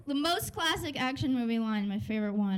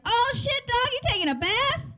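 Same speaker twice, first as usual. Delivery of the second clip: much higher, louder exclamation; acted loud surprise; 10 semitones higher, 4 dB louder than usual. Movie character walks in on his buddy bathing, loud mock surprise. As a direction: burst out, amazed at what you walked in on.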